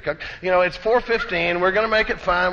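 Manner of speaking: ordinary talk, nothing marked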